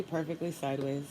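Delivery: normal talking voice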